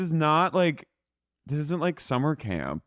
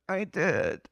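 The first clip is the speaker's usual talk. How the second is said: small higher-pitched retold reply; Raquel; 4 semitones higher than usual. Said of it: "And Raquel goes".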